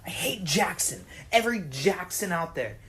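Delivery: normal talking voice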